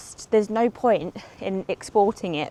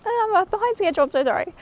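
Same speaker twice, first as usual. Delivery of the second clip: much higher, rushed apology on Oh, I'm behind schedule; apologetic squeal; 12 semitones higher, faster than usual. Retold or imitated. Replaying her apology to boyfriend on the phone, high and flustered. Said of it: "and I was like".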